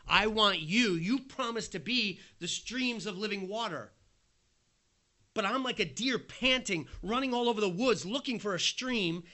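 impassioned lament to God; desperate pleading cry. Acted. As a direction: cry out like a parched man searching. Voicing David's desperate plea.

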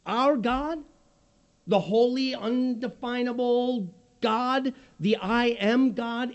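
ordinary delivery

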